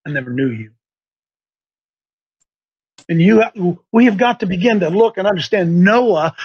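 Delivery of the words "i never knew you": dismissive Lord. Retold or imitated. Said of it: "He said".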